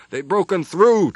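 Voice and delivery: slow, loud triumphant shout. Acted. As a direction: call it out loud. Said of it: "Trelavin shouted".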